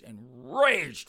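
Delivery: ENRAGED stretched out slowly; drawn-out emphasis. Real, not acted. Comic telling how angry the son is, ENRAGED drawn out. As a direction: draw out "enraged" for comic force. Narrator stresses son's rage.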